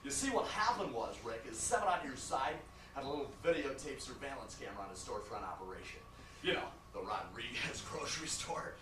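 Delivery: low-pitched, buddy-buddy attorney voice; chummy lawyer. Acted. Lawyer chatting to client about a cocaine bust, chummy. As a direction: play the genial lawyer telling a case story.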